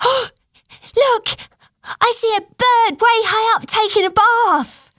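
put-on very high little-girl voice; high-pitched, wide-eyed girl calling out a discovery; excited child. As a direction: pipe up like an amazed small child. Young girl voiced, spotting a bird.

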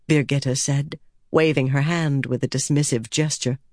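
ordinary delivery, nothing marked

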